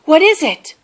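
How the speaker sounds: ask, suddenly woken; startled question. A narrator's reading. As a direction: Mouse jolted awake by Bear. Sleepy Mouse woken.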